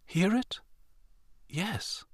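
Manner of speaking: quiet awed voices; soft awed exchange; hushed. A narrator's reading. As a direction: whisper so as not to wake him. Hushed exchange near sleeping God.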